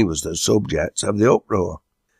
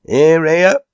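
high, loud rising "hear, hear"; cheer of approval; 11 semitones higher, 9 dB louder than usual. A narrator's reading. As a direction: back him as a whole crowd. Whole meeting answers in approval.

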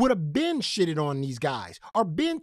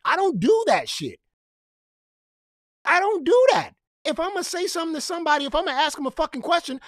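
heated shout; 6 semitones higher than usual. Real, not acted. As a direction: shout him down, fired up. Heated rant against Cali.

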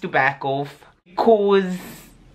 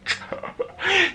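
high-pitched laughing burst; high, softer cracked-up laugh; burst of laughter; 14 semitones higher, 5 dB quieter than usual. Real, not acted. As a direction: break up laughing mid-sentence. Breaks up mid-denial.

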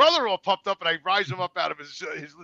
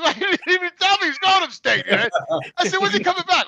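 high, loud, incredulous questions; exasperated questions; 12 semitones higher, 7 dB louder than usual. Retold or imitated. Asking the nephew about his brother, incredulous. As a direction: pitch it up in disbelief.

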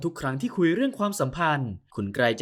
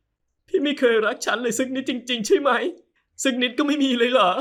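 put-on pleading young wife; 10 semitones higher, 4 dB louder than usual. Heartbroken wife pleading with husband for love, high voice. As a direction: beg him in a higher woman's voice.

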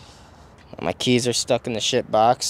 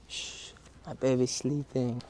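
whispering over a crib; 12 dB quieter, slower than usual. Pretend. Pretending to hush a baby mannequin.

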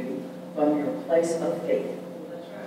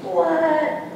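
a high, puzzled "What?"; mock confusion from the congregation; the listener is baffled by the title, high and puzzled; surprised what; 12 semitones higher, 3 dB louder than usual. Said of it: "And you're like".

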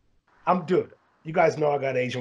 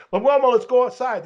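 mocking tough-guy challenge; 10 semitones higher, 7 dB louder than usual.

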